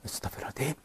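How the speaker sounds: breathless scared whisper, high; a spooked whisper of prayer; frightened whisper. Real, not acted. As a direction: gasp the prayer under your breath. Startled by a ghostly face.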